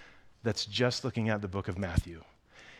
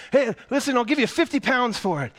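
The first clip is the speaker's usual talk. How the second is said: much higher, louder call; tall man bidding; 13 semitones higher, 9 dB louder than usual. Acted. A tall man bids for Charlie's ticket, calling out. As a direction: barge in with an offer. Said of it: "a tall man saying".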